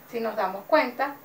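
ordinary delivery

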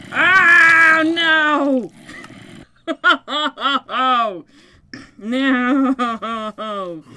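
loud laughing cries of no; laughing panic. Real, not acted. Gamer swarmed by mobs at spawn, laughing panic.

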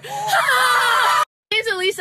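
mock intro. Retakes the intro drawn out.

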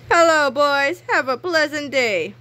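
put-on nice principal greeting the boys; cheery principal. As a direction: greet them brightly.

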